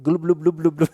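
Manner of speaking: loud, high mock chugging glub sounds; gulping noises. Man mimics chugging beer in mom's car, loud gulping. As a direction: imitate pounding beers fast. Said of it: "And I was like".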